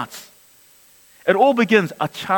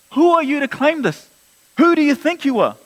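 higher, pointed accusing questions; indignant challenge; 6 semitones higher than usual. Retold or imitated. Teachers of the law confronting Jesus, mock indignant. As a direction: throw the question back at him in outrage.

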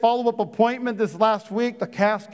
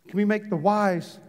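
usual speaking voice

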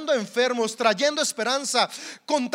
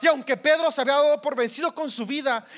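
ordinary talk

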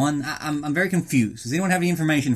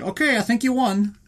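higher-pitched, lightly voiced referee; mock referee; 7 semitones higher than usual. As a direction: step in lightly as a hesitant ref. Acted referee stopping a fight.

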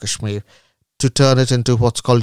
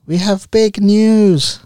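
announcing big news; 9 semitones higher, 5 dB louder, much slower than usual. Acted. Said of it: "We have an announcement to make".

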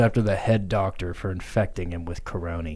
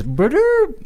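very high, loud squeaky bloop; cartoon creature call; 6 dB louder than usual. Grimace explains the rat ball, very high squeak. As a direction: pop it out like a goofy cartoon blob.

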